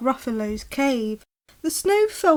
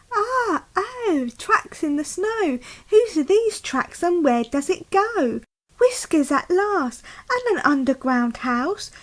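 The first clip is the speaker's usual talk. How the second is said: higher, excited little monster voice; eager Gruffalo child; 7 semitones higher than usual. Acted. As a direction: pester dad like a curious kid. Child finds tracks.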